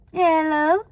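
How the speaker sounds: questioning hello with a rising lilt; answering the phone. Acted. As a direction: pick up the phone, voice lifting at the end.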